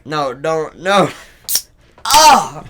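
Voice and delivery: no, no, then a sharp yelp; rising protest to a yelp. Real, not acted. Friend makes ASMR noises in mic.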